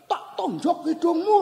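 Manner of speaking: high mock threat called out; high-pitched play-acted irritation; annoyed child. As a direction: imitate a cross kid hollering a threat. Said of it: "Wah, dia makin jengkel".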